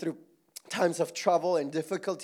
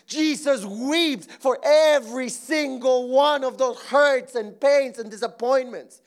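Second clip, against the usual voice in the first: normal talking voice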